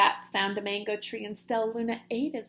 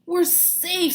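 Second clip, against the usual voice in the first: relieved; 6 semitones higher, 6 dB louder than usual. Acted. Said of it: "said Stellaluna".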